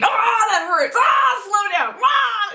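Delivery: high-pitched mock screams of pain; yelling in pain. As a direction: imitate an injured dad howling at every bump. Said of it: "he's yelling and screaming in pain".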